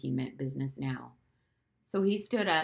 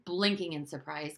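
usual speaking voice

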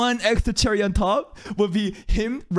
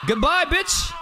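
taunting goodbye; 11 semitones higher, slower than usual. Real, not acted. Farewell taunt.

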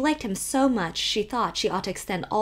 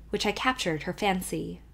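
plain reading voice

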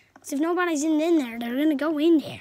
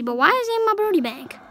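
ordinary talk

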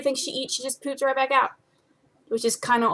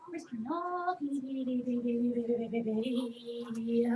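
quiet, high coo of a pet's name; sing-song the name to find her; soft cooing call; 4 semitones higher, 9 dB quieter than usual. Real, not acted. Owner softly cooing for her ferret Penny.

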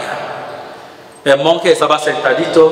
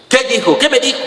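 frustrated; 9 semitones higher, much faster than usual. Retold or imitated. Man straining to hear the monk.

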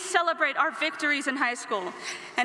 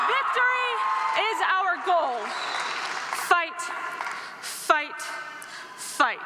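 rally shout; 3 semitones higher, slower than usual. Real, not acted. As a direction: pump up the crowd. School cheer with crowd.

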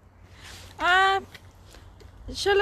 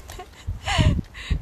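high delighted giggle; a burst of giggling, high up; 12 semitones higher than usual. Real, not acted. Laughing at the snow, high delighted giggle.

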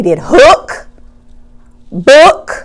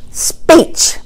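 Speech whispered softly; whispered word; 4 semitones higher, 6 dB quieter than usual. Shown as a demonstration. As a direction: breathe the answer. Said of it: "the word".

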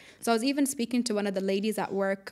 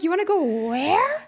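high, loud, mock-astonished question; incredulous retort; 8 semitones higher, 6 dB louder than usual. Mom reacting to the request to go far, incredulous. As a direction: imitate an astonished reaction, punching the last word up. Retold shocked reaction to distant trip.

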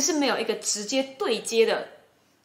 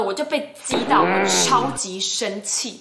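usual speaking voice

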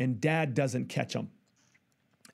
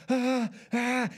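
panicked gasps; 8 semitones higher than usual. Retold or imitated. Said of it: "frantically built".

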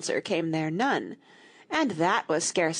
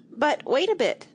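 high, louder call; crying out; 8 semitones higher, 4 dB louder than usual. Acted. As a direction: oysters calling out in alarm. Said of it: "the oysters cried".

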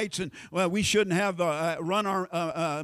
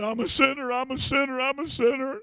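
higher-pitched put-on wail of a sinner; mock self-pitying confession; 4 semitones higher than usual. Preacher imitating people who run around confessing, mock self-pitying. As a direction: whine it on repeat, stressing SINNER. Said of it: "put the emphasis on the wrong syllable".

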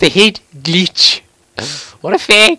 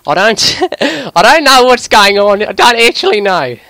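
higher, louder, laughing through the Aussie accent; breaking into laughter; 6 semitones higher, 5 dB louder than usual. Real, not acted. Gamer losing it over his own accent, laughing. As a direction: crack up halfway through and push on.

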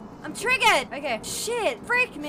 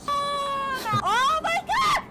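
long, high, slow wail; raging gamer; 9 semitones higher, much slower than usual. Real, not acted. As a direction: draw out the frustration. Frustrated drawn-out cry.

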